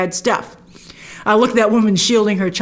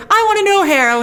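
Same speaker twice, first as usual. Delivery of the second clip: higher mock plea that settles on the name; pleading old woman; 10 semitones higher, faster than usual. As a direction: ask high and needy, then drop on the name. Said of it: "And she's like".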